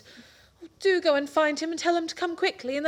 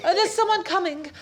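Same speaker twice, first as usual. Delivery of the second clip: ordinary delivery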